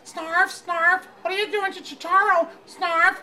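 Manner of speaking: high, loud, squealing questions; fanboy shouting at cartoon. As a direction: yell at the screen like a fan. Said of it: "and I was like".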